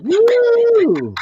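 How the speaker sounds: high whooping cheer; squealing celebratory woo; excited woo. Pretend. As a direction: whoop like you just scored. Imagined cheer if it were so.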